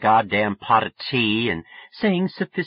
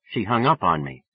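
ordinary delivery

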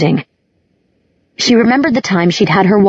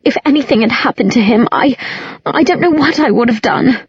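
lightly voiced, higher, near tears; tearful Isabel; 5 semitones higher than usual. A narrator's reading. Isabel fretting to Alec, a touch tearful. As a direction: hold back tears over Simon. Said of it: "Isabel sniffed loudly".

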